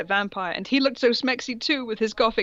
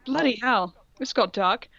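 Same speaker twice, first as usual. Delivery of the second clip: usual speaking voice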